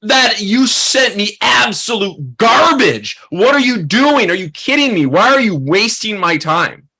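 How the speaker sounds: mock furious agent, slow and indignant; retold angry rant. Agent going off on a young photographer, mock angry. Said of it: "And he just went off on me".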